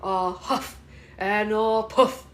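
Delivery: loud, drawn-out huffing threat, swooping up on blow; big bad wolf. Mother plays the wolf coming for the piggy, playful menace. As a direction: stretch and swell it like a storybook wolf. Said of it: "what does the wolf say when he comes".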